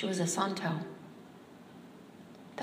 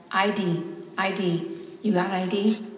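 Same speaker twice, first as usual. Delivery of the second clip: louder, insistent questioning; mild mock police demand; policeman demanding; 5 dB louder than usual. A narrator's reading. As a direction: bark the demand for papers.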